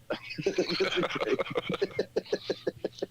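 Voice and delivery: breathy high laugh. Real, not acted. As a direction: burst out laughing. Laughs at own joke.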